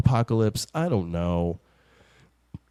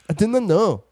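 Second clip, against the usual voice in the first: high, louder imitation of a Scottish "I didn't know"; imitating Scottish accent; 8 semitones higher, 5 dB louder than usual. Host mimicking her cohost's one Scottish accent line. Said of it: "the only Scottish accent you do".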